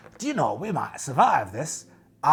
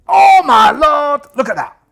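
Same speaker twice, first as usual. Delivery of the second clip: excited shout; 12 semitones higher, 16 dB louder than usual. Real, not acted. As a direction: shout it out in disbelief. Dice roll saves the character.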